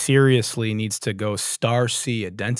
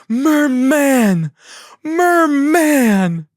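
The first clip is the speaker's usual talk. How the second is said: much higher, loud bellowed cry; Zoolander shout; 8 dB louder, much slower than usual. Retold or imitated. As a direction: scream the word like a movie character's outburst. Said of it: "It's a Zoolander reference".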